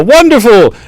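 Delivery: high-pitched, loud court jester. Acted. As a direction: pipe up as a jester welcoming contestants. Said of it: "the jester is like".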